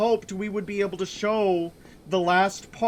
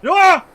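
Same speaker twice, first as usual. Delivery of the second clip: shocked yelp; 9 semitones higher, 11 dB louder than usual. Real, not acted. Sudden death shock.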